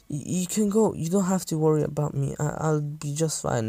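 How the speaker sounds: put-on small, high child's voice; lightly voiced meek kid; timid brushing-off. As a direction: tell them softly not to bother. Child timidly waves off help.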